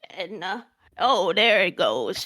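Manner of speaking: put-on slow, creaky old granny; old lady voice. Shown as a demonstration. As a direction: drag it out like a frail grandma. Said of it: "There's my old lady voice right there".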